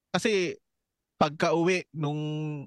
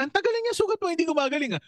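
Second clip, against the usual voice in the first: high-pitched quoted mother, surprised; mother's voice; 9 semitones higher, faster than usual. Retold or imitated. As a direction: raise the voice like a worried mother asking. Mother voiced scolding in story. (boses ng nanay na nagtataka)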